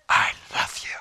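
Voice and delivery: whispered, weighty "I love you"; hushed and sincere, fully whispered; heartfelt whisper. Acted. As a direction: whisper it as a selfless vow. The selfless meaning of the phrase.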